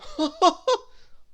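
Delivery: high giggling burst; can't hold back a giggle. Acted. Mister Arthur Loaf, being tickled, giggling.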